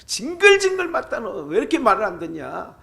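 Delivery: high, loud exasperated scolding; exasperated parent scolding. Retold or imitated. A parent scolding a child who won't listen, loud and high. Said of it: "애들한테 말하다가".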